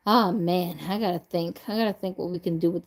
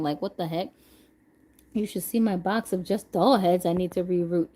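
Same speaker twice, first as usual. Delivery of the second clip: ordinary talk, nothing marked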